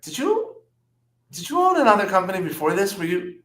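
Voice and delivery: high-pitched, dawning recognition; re-enacted startled question; incredulous questioning. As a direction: ask it haltingly, voice jumping way up. Acted startled question to stranger.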